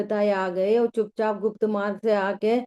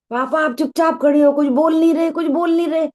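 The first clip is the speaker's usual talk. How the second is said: put-on loud, high, frantic child's cry; child's frantic shout; 6 semitones higher, 6 dB louder than usual. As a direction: shout like a panicked child watching gold burn. Child cries out at silent father. (पिता से घबराकर ऊँची आवाज़ में चिल्लाता बच्चा)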